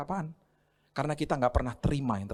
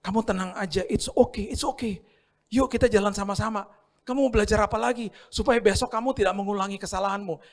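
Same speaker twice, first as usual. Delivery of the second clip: gentle comforting voice; 8 semitones higher than usual. Acted. Jesus gently comforting a sinner who keeps failing, high and tender. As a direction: coax them along kindly.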